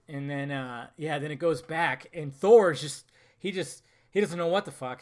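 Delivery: usual speaking voice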